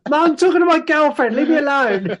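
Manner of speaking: put-on high whiny teen voice, breaking into laughter; a stroppy teenager begging. Teen shooing mum off the phone, high and laughing.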